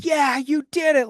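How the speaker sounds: put-on overexcited parent cheering her kid across the line; excited mock scream. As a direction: imitate a mom shrieking with joy. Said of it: "Like, just screaming, so excited".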